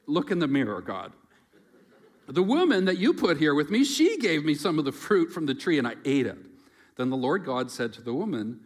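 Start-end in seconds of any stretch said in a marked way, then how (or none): 2.3-6.4 whiny blaming. Acted. Adam shifting blame.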